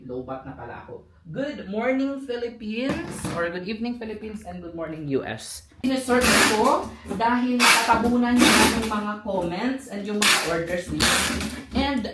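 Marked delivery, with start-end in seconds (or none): 1.2-5.6 live stream host. Acted. Live selling greeting to viewers. 5.8-12.0 higher, louder host voice; live stream host. Acted. Live selling host talk.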